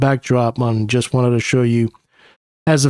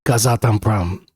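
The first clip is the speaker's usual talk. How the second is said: Kazatomprom in a put-on Russian accent, lower; mock Russian accent; 4 semitones lower than usual. Shown as a demonstration. Investor showing off the name, put-on Russian accent. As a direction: say Kazatomprom like a Russian local for authority. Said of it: "When I say it with an accent like that".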